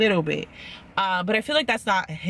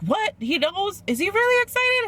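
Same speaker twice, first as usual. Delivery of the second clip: much higher, rising astonished questions; shocked disbelief; 13 semitones higher than usual. Retold or imitated. Kwame hearing his dad is excited, high and astonished. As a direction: squeal out the surprise.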